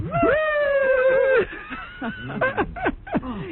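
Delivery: imitating a silly high laugh; high mock laugh. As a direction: squeal out a mocking laugh. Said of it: "You hear the guy go".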